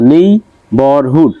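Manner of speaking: নেইবারহুড split slowly as নেই, বার, হুড; syllable by syllable. Shown as a demonstration. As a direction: say নেইবারহুড in three separate beats. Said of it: "তিনটা syllable দেওয়া আছে".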